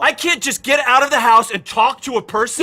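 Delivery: loud, high, defensive yell; yelling back at his girlfriend, loud and high; angry shouting. Acted. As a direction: shout him down, outraged.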